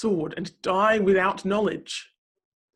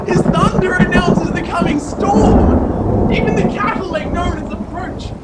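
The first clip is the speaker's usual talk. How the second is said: thunderous proclamation; 10 semitones higher, 15 dB louder than usual. Acted. Reader proclaiming God's roaring voice to the listener, full-force shouting. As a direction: preach it at full force, loud and high, shouting over the storm. Reading builds to the storm.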